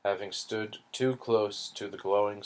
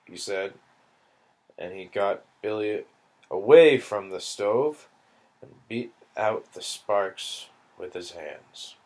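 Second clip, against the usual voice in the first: usual speaking voice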